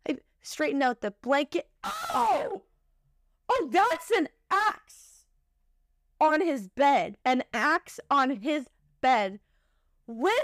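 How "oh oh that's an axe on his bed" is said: shocked realization. Retold or imitated. Discovering axe on bed.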